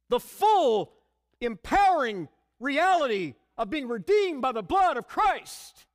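preaching emphasis. Acted.

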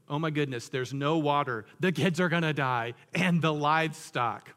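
loud, hammed-up catastrophizing; mock panic. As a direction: build to overblown dread, dragging out the end. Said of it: "they literally go to the worst case scenario".

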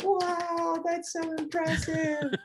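mock amazed sharks. Sharks at the meeting gushing over Bruce, mock amazed. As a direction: ham up being deeply impressed. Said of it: "And they're like".

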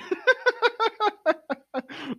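shrieking high laughter; helpless squealing laugh; high-pitched laugh. Real, not acted. Friend crying from heat.

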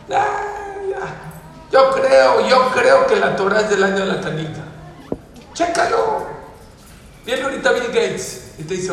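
high, loud sermon voice, pressing the congregation hard; loud, high preacher urging his listeners; fiery preaching. Acted. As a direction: preach it with full force. Preaches at full force.

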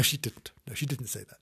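usual speaking voice

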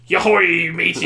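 lightly voiced pirate; a hint of an old sea dog. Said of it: "like, pirate voice".

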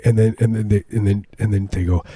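ordinary talk

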